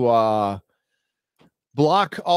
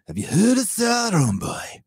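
high mock shout of a sneering villain; 6 semitones higher than usual. Mocking the villager who follows Sauron, in a sneering mock shout.

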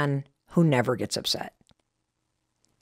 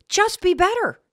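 mock scream; 12 semitones higher than usual. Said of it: "Chris did scream at me".

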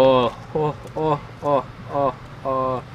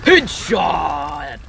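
triumphant call. Pretend. Calls out a kill in game.

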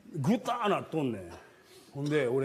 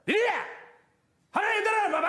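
angry dad yelling, much higher; retold angry shout; faster than usual. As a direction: snap at her to get away. Father yelling at toddler.

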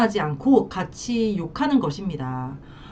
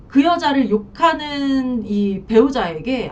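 ordinary delivery

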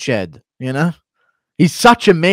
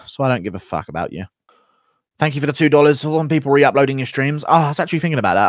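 ordinary delivery

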